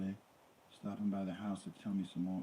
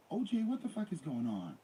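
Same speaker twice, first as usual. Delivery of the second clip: shocked; 11 semitones higher, 3 dB louder than usual. Acted. Nicole confronts them in shock.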